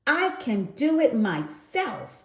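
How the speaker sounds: high loud shout. A narrator's reading. Froggy shouts at Frogolina, a loud high shout. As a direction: shout it, stubborn and proud. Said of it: "shouted Froggy".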